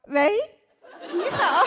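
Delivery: high sweet phone greeting; cutesy sweet voice. Acted. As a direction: put on the city phone voice. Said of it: "在大城市工作说话他就这样".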